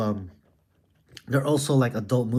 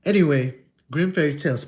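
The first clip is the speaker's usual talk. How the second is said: ordinary delivery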